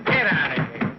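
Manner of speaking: loud order to leave; angry dismissal. Acted. Sergeant orders private out of his office, shouting. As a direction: throw him out with a shout.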